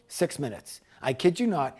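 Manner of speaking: usual speaking voice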